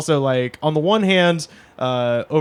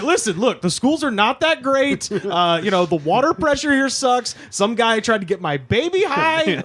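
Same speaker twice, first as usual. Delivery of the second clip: high, pleading voice pitched to a husband; mock complaining wife; 8 semitones higher than usual. Friend imitating the mother complaining to her husband. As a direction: argue to move out of town. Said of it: "They were like".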